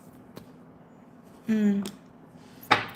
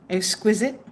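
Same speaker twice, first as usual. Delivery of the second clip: ordinary talk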